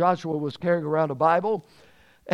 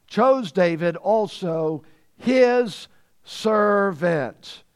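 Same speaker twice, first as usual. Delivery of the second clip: very slow, drawn-out "his servant"; emphatic scripture reading; much slower than usual. Real, not acted. Preacher reading we read these words to the church, slow and emphatic. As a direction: stretch out "his" and "servant" for weight.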